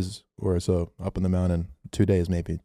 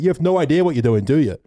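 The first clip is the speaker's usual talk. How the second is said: higher, louder scolding stranger; mock scolding; 7 semitones higher, 5 dB louder than usual. Stranger from a van scolding the city kids, louder and higher. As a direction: tell them off like they're clueless. Angry stranger voiced in story.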